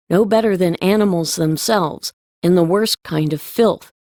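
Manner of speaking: ordinary delivery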